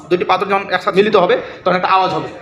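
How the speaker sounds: normal talking voice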